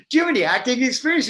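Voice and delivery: high, rapid disbelieving question; mock incredulous. Jealous students quizzing the carpenter, mock incredulous.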